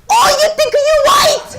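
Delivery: put-on high, loud Chinese-accented mom shout; imitating mom. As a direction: bark it like an angry immigrant mom. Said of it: "my mom, she runs down".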